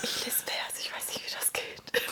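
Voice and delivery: a giggly ASMR whisper. Shown as a demonstration. Joking to the listeners about her lisp, whispering. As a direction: try a whisper and admit you lisp. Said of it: "wenn du flüsterst".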